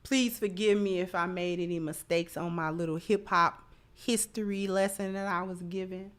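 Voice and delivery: ordinary talk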